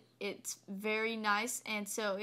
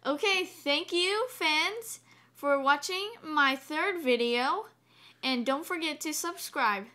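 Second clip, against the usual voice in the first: higher, brighter sign-off to the fans; cheery vlog outro; 5 semitones higher, 5 dB louder than usual. Acted. Girl signs off her video to viewers, bright and upbeat.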